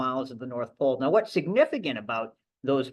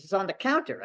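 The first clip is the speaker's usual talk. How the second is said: put-on light Australian accent, pitched higher; breezy Aussie bloke, lightly imitated; a hint of a casual Australian accent; Australian mate; 7 semitones higher than usual. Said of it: "he was Australian".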